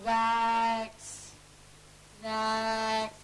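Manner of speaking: "Wax." with the W drawn out; prolonged W. Shown as a demonstration. Teacher modelling prolongables, W drawn out. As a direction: stretch the W of "Wax.". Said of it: "I'm just, so".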